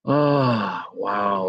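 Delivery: ordinary delivery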